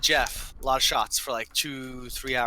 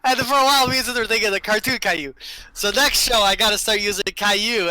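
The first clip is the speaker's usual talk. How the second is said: louder, higher words through laughter; laughing while talking; 5 semitones higher, 4 dB louder than usual. Real, not acted. Laughing after punchline.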